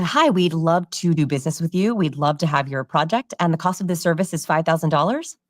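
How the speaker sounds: salesperson pitch with DOLLARS lifted into a question; even pitch until "dollars" rises, unsure of the price; asking their number. Shown as a demonstration. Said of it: "So it sounds like this".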